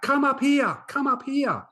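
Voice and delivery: high, loud insistent call; yelling out. Retold or imitated. Cool kid keeps calling the new boy up the hill, loud high shout. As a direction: keep shouting him up the hill. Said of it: "just kept yelling out".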